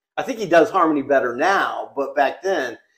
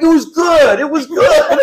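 higher and louder, defensive; higher, louder imitated protest; mock shout; 10 semitones higher, 5 dB louder than usual. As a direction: yell it back indignantly. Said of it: "he was like".

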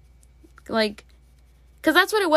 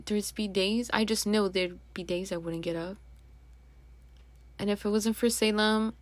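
ordinary talk, nothing marked

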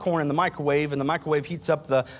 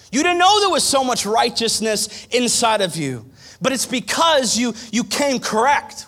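rising preaching; 8 semitones higher, 6 dB louder than usual. Acted. Pastor to church, loud building preaching climax. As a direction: build it up till the soul breaks through.

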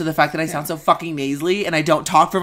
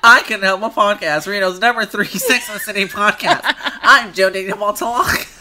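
higher, giggly mock podcast intro; exaggerated host voice; 5 semitones higher than usual. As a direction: ham up your own host voice. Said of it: "exaggerate the fuck out of it".

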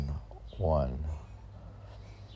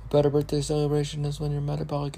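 much higher and fast, hammed-up recitation; mock excited reading; 12 semitones higher, 5 dB louder, much faster than usual. Host rereads the odd poem line in a much higher, fast, hammed-up voice. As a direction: read it like a giddy overexcited prophet.

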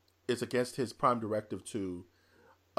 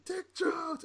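panicked thug yelp. Retold or imitated. Thug repeats boss name.

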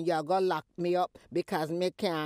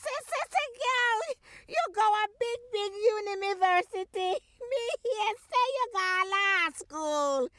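excited boasting; 13 semitones higher, 3 dB louder than usual. Acted. Mother boasting about daughter.

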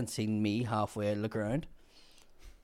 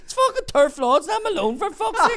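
very high, loud strained yell; imitating his indignant shouting; exasperated bellow; 10 dB louder than usual. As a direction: holler it out in exasperation. Man yelling from toilet, acted.

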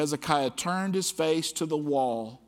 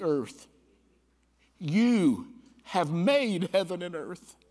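fervent prayer reading; 3 semitones higher than usual. Acted. Preacher reading Hezekiah's plea to God, fervent prayer. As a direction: pray it aloud to the congregation.

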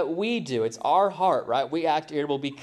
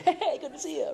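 much higher, silly sing-song greeting as a medicine-ad extra; mock cheery ad actor; 13 semitones higher than usual. Pastor mocking carefree commercial people, squeaky high cheer. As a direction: parody the grinning people in a drug commercial.

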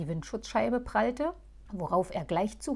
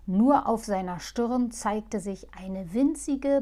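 ordinary delivery, nothing marked

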